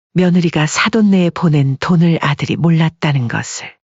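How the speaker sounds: ordinary delivery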